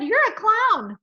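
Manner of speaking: high, loud delighted announcement; thrilled high exclamation; beaming, real happy. A narrator's reading. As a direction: proudly unveil it to a child. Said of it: "she beamed real happy".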